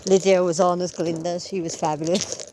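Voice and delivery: ordinary delivery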